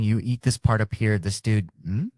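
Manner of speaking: usual speaking voice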